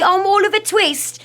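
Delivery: high, loud Oliver Twist Cockney; Cockney accent. Retold or imitated. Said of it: "When you do a Cockney".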